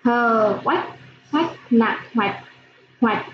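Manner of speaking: careful teacher spelling of hoạch; spelling out syllables. Shown as a demonstration. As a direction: sound out Hờ, oách, then add the nặng tone to get hoạch. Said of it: "Các em nghe cô đánh vần".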